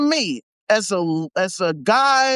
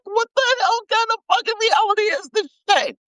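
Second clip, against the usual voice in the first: exasperated shout; 10 semitones higher, faster than usual. Real, not acted. Exasperated rant outburst.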